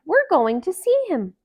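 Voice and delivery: can't wait to see him; excited. Acted. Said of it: "the animals cried".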